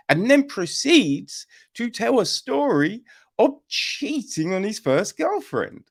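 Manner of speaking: ordinary talk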